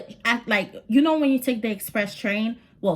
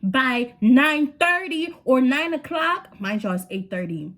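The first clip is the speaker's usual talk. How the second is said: lightly voiced stern mother; warning mom; 5 semitones higher than usual. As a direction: play the strict mother setting a deadline. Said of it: "She's like".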